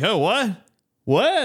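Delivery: high, confused mock innocence; playing dumb. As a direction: act clueless to get past the guard. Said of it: "pretend you're really dumb".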